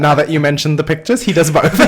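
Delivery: helpless laughter through the words, high and loud; laughing. Real, not acted. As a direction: crack up while talking, quick and high. Cracking up over pictures.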